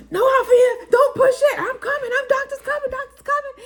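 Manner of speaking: high, loud, frantic pleading; panicked husband. Retold or imitated. Mother imitates her frantic husband, high and loud. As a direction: play the flustered husband begging her to wait. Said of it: "all I saw was".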